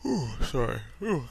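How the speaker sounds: through a yawn. Real, not acted. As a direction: yawn while saying it. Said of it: "got a little big yawn".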